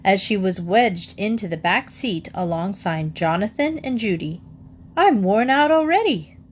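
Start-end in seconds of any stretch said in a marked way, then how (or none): 4.9-6.4 high-pitched weary complaint; put-upon protest, pitched up; exasperated gasp. A narrator's reading. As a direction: wail it high, as a story character. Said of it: "gasped Missus Bird".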